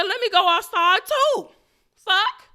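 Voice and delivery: high, loud panicked holler; urgent shout. Retold or imitated. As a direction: yell it while rushing after someone. Acting her rush outside.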